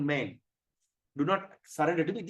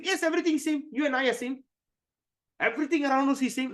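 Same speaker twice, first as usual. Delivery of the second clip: high-pitched, emphatic mimicked answer; insistent believer; 9 semitones higher, faster than usual. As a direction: imitate a group member cheerfully insisting, pitch raised. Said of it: "I asked them".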